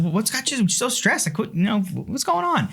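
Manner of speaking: high-pitched, hammed-up bafflement at someone's stress; exaggerated high incredulous questioning; mock clueless husband. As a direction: imitate a husband who just doesn't get it. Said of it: "my go-to answer is never good".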